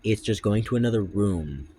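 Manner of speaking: ordinary delivery, nothing marked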